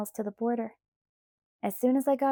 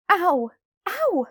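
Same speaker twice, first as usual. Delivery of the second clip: sharp high yelps of pain, much louder; screaming in pain; 9 semitones higher, 8 dB louder than usual. A narrator's reading. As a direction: cry out from a broken hand. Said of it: "I screamed".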